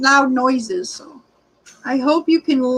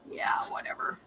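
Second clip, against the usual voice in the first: whisper; 16 dB quieter than usual. Real, not acted. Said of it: "whispered aside".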